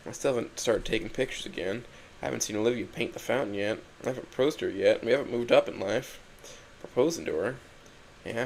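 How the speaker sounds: usual speaking voice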